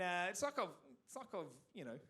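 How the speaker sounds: usual speaking voice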